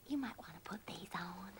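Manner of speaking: soft unvoiced whisper; a mother whispering to a hurt boy. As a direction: whisper it kindly, just to him. Motherly aside to hurt man.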